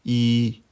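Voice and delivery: I held high and perfectly level; first tone on I. Shown as a demonstration. Teacher showing learners Mandarin I, high level tone. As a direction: say I long, high and even. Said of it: "Une dernière fois".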